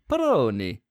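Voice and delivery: put-on Italian accent. Shown as a demonstration. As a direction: say it like an Italian impressionist. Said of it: "hear that said in an Italian accent".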